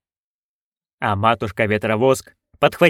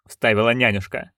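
ordinary delivery, nothing marked

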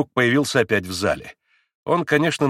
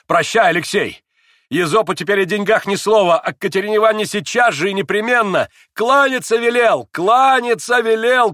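loud, high, emphatic declaiming; frenzied parting orders; 10 semitones higher, 6 dB louder than usual. A narrator's reading. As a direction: feverish shouted farewell.

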